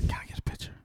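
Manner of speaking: creepy mock whisper; hushed, voiceless fan request; retold whisper. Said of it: "Like a creep".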